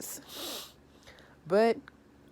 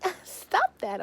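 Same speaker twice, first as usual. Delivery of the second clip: much higher playful protest; mock squeal; 3 dB louder, slower than usual. Chantel after Pedro slaps her with the towel, high squeal. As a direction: squeal it up high, pretend annoyed. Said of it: "You're like".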